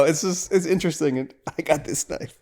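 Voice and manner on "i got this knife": silly quote. Retold or imitated. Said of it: "really silly".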